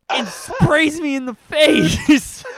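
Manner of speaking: slow, loud punchline breaking into a laughing yell; laughing shout. Real, not acted. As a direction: shout the payoff through laughter. Punchline of dad's windshield prank.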